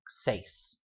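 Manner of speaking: "Seis" whispered, one syllable; whispered example. Shown as a demonstration. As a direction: breathe "Seis" unvoiced. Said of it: "Seis itself does not have an accent mark".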